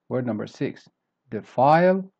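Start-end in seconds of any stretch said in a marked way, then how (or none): 1.3-2.1 "defile" with weak "de", high loud "file"; weak then up. Shown as a demonstration. Teacher modelling word number six, "defile" stressed on the second syllable. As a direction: go up hard on the end of "defile". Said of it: "start weak and then you go up".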